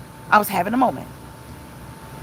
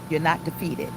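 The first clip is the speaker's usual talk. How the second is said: ordinary talk, nothing marked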